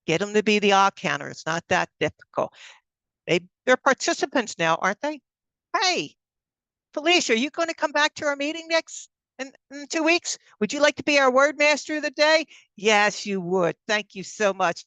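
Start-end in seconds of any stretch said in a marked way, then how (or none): 5.7-12.5 upbeat invite. Shown as a demonstration. Modelling signing up a guest.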